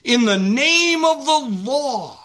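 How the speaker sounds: lightly acted police order, slow and ringing; cop's shouted order. Pastor imitates movie cop chasing a robber, mock commanding call. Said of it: "the cop is chasing the bad guy and he says".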